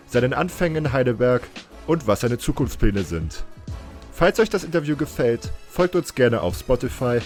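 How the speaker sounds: podcast intro. Acted. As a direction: read the show intro with projected, lifted energy. Host's scripted podcast intro in German.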